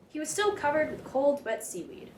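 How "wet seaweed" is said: ordinary delivery, nothing marked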